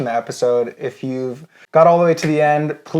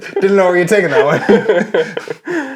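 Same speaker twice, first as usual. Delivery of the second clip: high, slow words through laughter; amused, breaking into laughs; laughing while talking; 6 semitones higher, 4 dB louder, slower than usual. Real, not acted. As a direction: crack up as you say it. Cracks up at co-host's joke.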